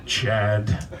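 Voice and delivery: mock a gentle rehab-ad announcer; lightly voiced soothing commercial narrator. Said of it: "The guy's got that soothing voice".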